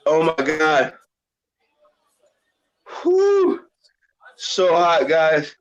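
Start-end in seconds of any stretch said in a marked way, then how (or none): 3.0-3.6 high pained whew. Real, not acted. Blowing out after the hot chip.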